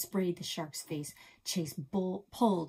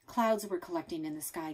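usual speaking voice

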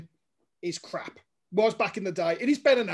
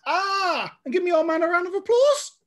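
high, raised storytelling shout; imitated crowd roar; 9 semitones higher, 5 dB louder than usual. Mate copying the old man's reaction, loud mock cheer. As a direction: build it up to a loud finish.

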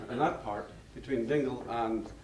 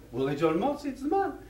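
high, gleeful confirmation; enthusiastic Irishman; 12 semitones higher than usual. Retold or imitated. Irishman agreeing about a music teacher, high and gleeful. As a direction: cheer the old teacher's name. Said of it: "getting more and more excited".

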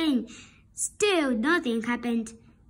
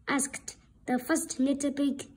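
ordinary delivery, nothing marked

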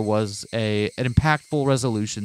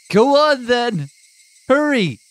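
furious shout; 12 semitones higher, 5 dB louder, much slower than usual. Acted. Keiichi yelling at Rena.

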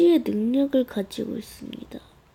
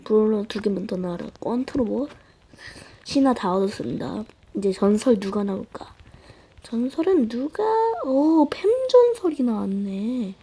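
normal talking voice

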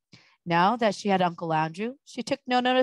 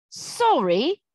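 high, rising incredulous echo; exclaimed in disbelief; indignant exclamation; 6 semitones higher than usual. A narrator's reading. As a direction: throw the word back as a question. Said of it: "exclaimed Diggory".

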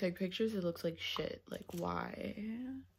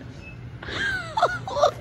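high giggle. Real, not acted. Laughs at remark.

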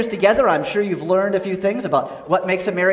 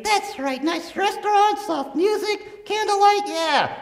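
squeaky ventriloquist dummy; 9 semitones higher than usual. Acted. Dummy lists romance.

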